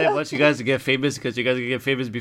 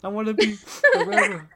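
high mock wail, half laughing; imitating a crying friend. Retelling how Kayla cried at the barbecue, mock wail. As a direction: whine it like a hammed-up sob. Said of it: "Kayla just started crying. She was like".

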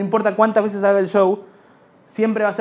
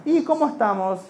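put-on higher childlike voice; cheerful kid greeting; higher voice of a young boy; inner child; 5 semitones higher than usual. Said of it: "me dice".

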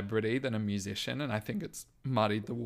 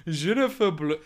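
put-on French, higher and louder, teeth together; gritted-teeth French voice; 6 semitones higher, 5 dB louder than usual. Trying the lyric once more for co-host, gritted-teeth French voice. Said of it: "gritted together".

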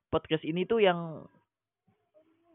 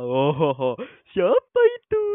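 playful feigned ignorance; 10 dB louder, slower than usual. Pretend. Feigning not knowing celebrity.

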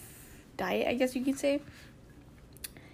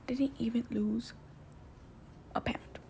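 whispered confession; whispering; 11 dB quieter than usual. Real, not acted. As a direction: drop to a whisper. Admits own failure.

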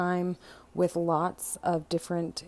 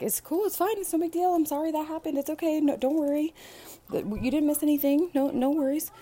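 much higher, soothing teacher voice; reassuring teacher; 10 semitones higher, 3 dB louder than usual. Acted. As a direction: comfort the kid, sweetly dismissive. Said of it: "the Bible study teacher, like".

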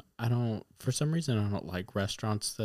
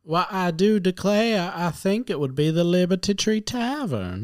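southern belle; 9 semitones higher, 7 dB louder than usual. Pretend. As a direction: play a genteel Southern lady. Put-on genteel drawl answering.

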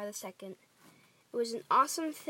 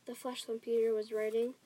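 ordinary delivery, nothing marked

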